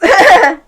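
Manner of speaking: loud high laugh bursting out; burst of laughter. Real, not acted. Host laughing at having only five minutes, loud and high.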